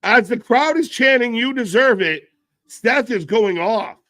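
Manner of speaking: high, loud live reaction; fired-up fan reporting; excited relay. Real, not acted. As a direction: call out the crowd's uproar, high and loud. Relaying crowd chant live.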